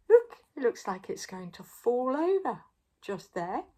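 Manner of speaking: ordinary delivery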